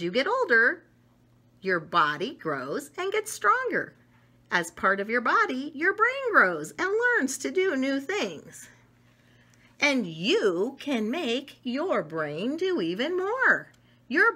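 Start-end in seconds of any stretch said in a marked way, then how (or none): none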